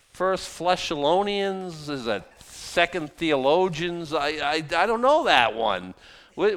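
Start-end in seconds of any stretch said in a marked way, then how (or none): 0.1-4.1 sarcastic slow. Real, not acted. Sarcastic fake books.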